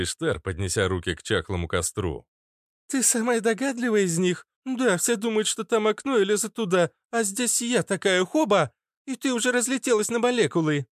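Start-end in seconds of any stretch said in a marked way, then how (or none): 2.9-10.9 high, lively boastful squeak; gleeful girl. Acted. Girl explains her ambush to Ishtar, high and pleased. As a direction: explain the trick with childish pride. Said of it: "улыбнулась девушка".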